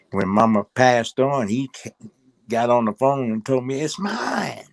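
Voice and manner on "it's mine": high-pitched imitation of a greedy claim; mock possessive claim. Stepson quotes stepfather's phone call after mama's death, high mocking claim.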